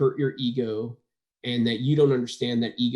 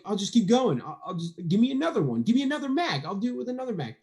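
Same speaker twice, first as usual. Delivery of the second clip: excited; 9 semitones higher, faster than usual. Retold or imitated. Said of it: "I'm like".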